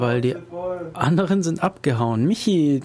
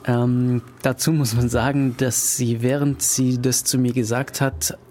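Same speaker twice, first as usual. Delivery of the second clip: ordinary talk, nothing marked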